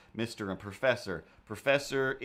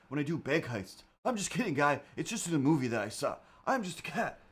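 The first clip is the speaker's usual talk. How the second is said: high dude-bro cat voice, higher; a surfer bro cat asking about the glove; 6 semitones higher than usual. Acted. Voicing the cat Ripley talking to streamer, high surfer bro. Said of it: "I'm not doing a voice for you".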